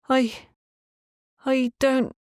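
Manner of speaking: put-on a frightened girl stumbling over words; Mavorin's halting voice. Young woman to the landlady, high and halting. Mavorin falters, can't lie.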